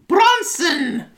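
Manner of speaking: high, loud call. Pretend. Calling out a character name.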